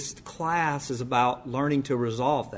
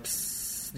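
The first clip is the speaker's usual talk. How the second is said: unvoiced hiss of a burning fuse; soft hissing fuse noise; 15 dB quieter than usual. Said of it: "they're like".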